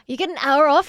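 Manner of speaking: thrilled for them; excited. Real, not acted. Excited reaction to chat.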